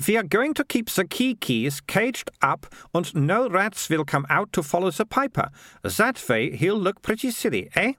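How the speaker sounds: put-on high-pitched girl voice; Militia. Girl explaining her adventuring bag, high and self-assured. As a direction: launch into a far-fetched tale, pitched high.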